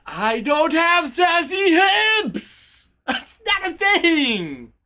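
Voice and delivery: high, drawn-out protest; indignant outburst. Real, not acted. Streamer denying chat's sassy hips remark, outraged and drawn-out. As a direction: stretch each word into an outraged cry.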